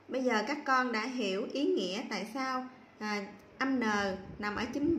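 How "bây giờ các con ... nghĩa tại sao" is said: ordinary delivery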